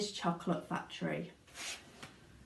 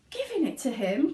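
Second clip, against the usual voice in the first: breathless, astonished question; gasped; 7 semitones higher, 4 dB louder than usual. Acted. Grandpa Joe hearing factory is Charlie's, gasping. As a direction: gasp it out in disbelief. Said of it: "gasped Grandpa Joe".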